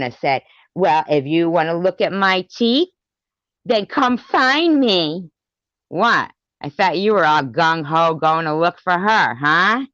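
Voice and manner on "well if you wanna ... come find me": mocking quote. Mimicking the rival's taunt to Sharon, high and mocking. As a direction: parody the rival's challenge. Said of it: "you would have said to Sharon".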